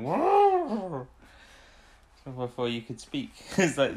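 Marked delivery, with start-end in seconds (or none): 0.0-1.1 loud, high imitation elephant trumpet; big mock trumpet blast. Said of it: "elephant trumpeting going".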